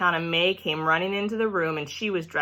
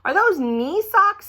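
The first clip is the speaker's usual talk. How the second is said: much higher and slow, astonished; amazed; 13 semitones higher, 5 dB louder, slower than usual. A narrator's reading. Sheldon spotting the principal's socks, high amazed. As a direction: gape at the socks out loud. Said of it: "He raised his eyebrows".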